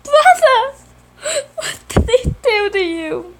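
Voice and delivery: high, loud, crying cry of shock; crying out in alarm. Acted. Simon shocked to see hurt brother, crying loud and high. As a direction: cry out over your hurt brother, voice breaking.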